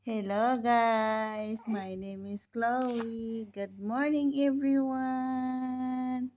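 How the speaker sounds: playful voicing of a baby greeting viewers; loud, slow, drawn-out greeting; lightly sing-song pretend baby introduction; Chloe.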